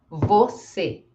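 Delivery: "você" ending in closed ay. Shown as a demonstration. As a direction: say "você" as vo-SEH with a narrow, closed E. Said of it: "com som fechado".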